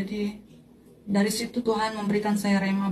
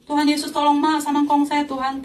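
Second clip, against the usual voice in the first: higher-pitched, urgent retold plea; calling on Jesus; 6 semitones higher, 3 dB louder than usual. Granddaughter begging Jesus to help her grandparents, urgent calling out. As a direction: call out for help, imploring. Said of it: "saya panggil nama Tuhan Yesus".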